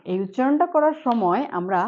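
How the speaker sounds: ordinary talk, nothing marked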